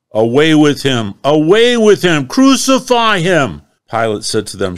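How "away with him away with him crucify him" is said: building to high, loud mob shouting; crying out. Acted. Crowd demanding crucifixion, building furious shout. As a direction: rise from demand to screaming frenzy. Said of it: "They cried out".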